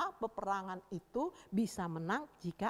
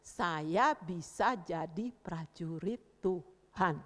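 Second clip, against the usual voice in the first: lower, very slow, word by word; measured repeat-after-me; 6 semitones lower, much slower than usual. Shown as a demonstration. Teacher repeating the pledge for children, slow and subdued. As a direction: spell out the pledge calmly for children.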